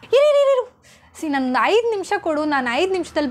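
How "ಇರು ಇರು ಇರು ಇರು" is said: high loud frantic wait-wait-wait; panicked stop. Acted. Daughter stopping mother from taking the phone, frantic shout. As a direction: stop her before she grabs the phone.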